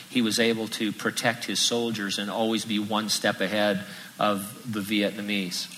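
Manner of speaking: ordinary talk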